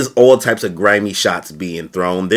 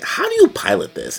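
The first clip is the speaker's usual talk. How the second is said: much higher puzzled question; confused Min. Retold or imitated. Min asking how to pilot the Zord, in a high puzzled voice. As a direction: sound lost at the controls. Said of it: "Min immediately questions".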